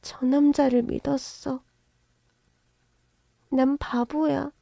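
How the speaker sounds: unvoiced, high, slow heartbroken confession; broken whisper. Daughter confessing to her mother she trusted him, hushed and broken. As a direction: whisper it through hurt.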